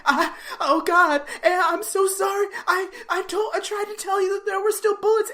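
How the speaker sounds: high-pitched, stammering horrified apology. Retold or imitated. Barney apologizing to Andy after the gunshot, horrified. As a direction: blurt a stammered, high apology in mock horror. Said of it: "the sort of the cartoonishness subsides".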